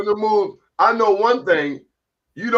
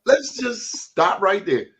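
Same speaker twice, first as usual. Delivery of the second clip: higher, laughing stop; laughing interruption; 8 semitones higher than usual. Real, not acted. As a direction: cut in, laughing. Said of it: "halting the story, laughing".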